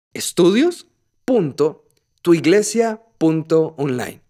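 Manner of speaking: slow dictation with clear pauses; dictando despacio. Real, not acted. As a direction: spell out the address piece by piece, pausing. Address repeated for listeners to note. (dictado lento y pausado)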